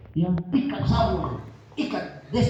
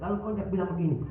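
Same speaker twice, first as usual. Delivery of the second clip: normal talking voice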